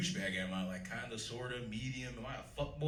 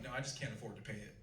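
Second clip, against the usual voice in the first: much softer, offhand dry aside; soft throwaway punchline; deadpan mumble; 11 dB quieter, faster than usual. Retold or imitated. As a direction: drop it under your breath. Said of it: "I'm like".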